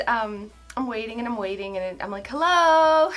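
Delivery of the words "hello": drawn-out, rising hello; impatient calling out. Retold or imitated. Driver still waiting at the silent box, drawn-out calling out. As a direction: call out for an answer, stretching the word.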